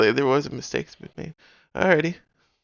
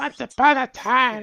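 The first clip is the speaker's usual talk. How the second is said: high, louder cackling witch voice; 11 semitones higher, 5 dB louder, slower than usual. Acted. Streamer to chat, telling a story in a high witch voice. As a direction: croak it out like a fairy-tale witch. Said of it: "That's more like a witch voice".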